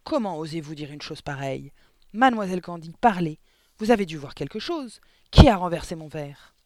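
loud furious demand; furious yell. A narrator's reading. Headmistress yelling at Miss Candy, loud fury. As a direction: rage at the teacher. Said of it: "vociféra mademoiselle Le Gourdin".

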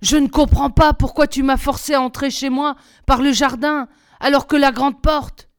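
ordinary delivery

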